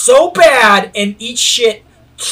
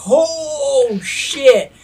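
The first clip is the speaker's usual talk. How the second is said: higher startled gasp of words; crowd's shocked reaction; 6 semitones higher than usual. Retold or imitated. Crowd watching a skater slam, mock shock.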